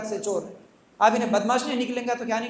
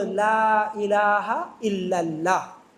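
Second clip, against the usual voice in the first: slow, lower, syllable by syllable; teaching a child slowly; 4 semitones lower, much slower than usual. Retold or imitated. Mother teaching her little son the kalima, slow and gentle. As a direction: spell it out gently for a small child. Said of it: "क्या बेटा बोलो".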